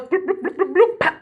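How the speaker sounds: high cartoon bloops ending in a pop; imitated popping noises. Shown as a demonstration. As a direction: bubble up high, then pop. Imitates the knot coming undone.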